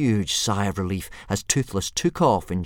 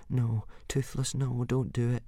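much softer, anxious hush; worried plea; 2 semitones higher, 10 dB quieter than usual. Acted. Hiccup begging Toothless to stop, softly. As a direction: plead under your breath. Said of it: "said Hiccup".

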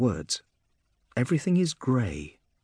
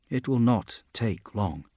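ordinary delivery, nothing marked

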